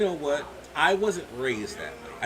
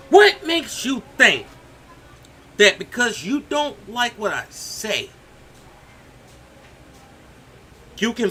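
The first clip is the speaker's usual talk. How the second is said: angry; 5 semitones higher, 8 dB louder than usual. Real, not acted. Confronting Flip Flop angrily.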